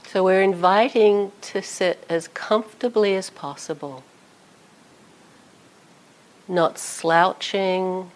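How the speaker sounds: calm guided body scan with long pauses; unhurried meditation voice, leaving space after each phrase. Real, not acted. Teacher guiding sitters through a body scan, calm meditation voice.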